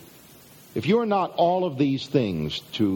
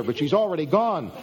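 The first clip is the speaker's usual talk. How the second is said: normal talking voice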